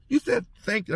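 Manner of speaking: high, amazed; excited inmate. Retold or imitated. Inmate amazed a guard said thanks, high excited voice. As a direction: marvel at it, pitched high. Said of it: "He goes".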